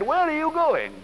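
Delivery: high, sly sea creature voice; eel voice. Acted. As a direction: ask it like a slippery eel.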